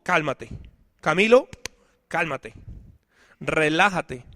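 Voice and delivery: self-soothing. Retold or imitated. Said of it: "diciéndome a mí mismo".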